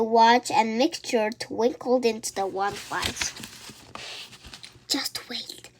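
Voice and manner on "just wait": soft secret whisper. A narrator's reading. Mirabel hushing her friend over the potion, whispering. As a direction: breathe the words without voice. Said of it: "whispered Mirabel".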